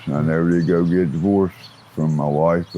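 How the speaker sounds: ordinary talk, nothing marked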